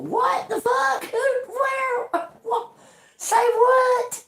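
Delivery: high, cracking yell of disbelief; outraged shout. Real, not acted. Ranting at soul-sellers, shouting in disbelief. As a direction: shout it like you can't believe it.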